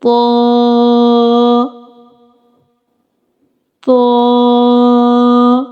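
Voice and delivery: "طا" with a thick, heavy consonant, held long; thick sound. Shown as a demonstration. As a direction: draw out a thick "طا" on a level note. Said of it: "with thick sound".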